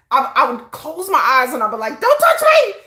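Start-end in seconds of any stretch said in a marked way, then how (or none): 2.0-2.7 high, loud frightened cry; high panicked yelp telling them to back off; panicked shriek. Pretend. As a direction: shriek it like you're terrified. Acting own panicked reaction.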